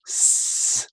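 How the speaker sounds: "s" as a long unvoiced hiss; isolated S sound. Shown as a demonstration. Teacher showing pupil the closed-mouth sound, hissed S. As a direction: hold a steady hissed "s". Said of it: "'cause it's an S sound".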